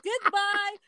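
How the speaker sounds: high-pitched, breaking into real laughter; cracking up. Real, not acted. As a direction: try to talk while helplessly laughing. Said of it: "I don't know why I'm cracking up".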